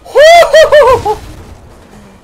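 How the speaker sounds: very high, loud whooping laugh; hammed-up manic cackle; maniacal laugh. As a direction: let out a shrieking cackle of glee. Glee at destroying everything.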